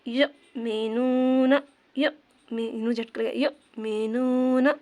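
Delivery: यमीनूना with a light catch after यमी, drawn out slowly; यमीनूना slowly, a glottal jerk in the middle; slight jerk. Shown as a demonstration. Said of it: "यहाँ भी झटका लेकर हल्का सा". (यमीनूना हल्का सा झटका लेकर, धीरे)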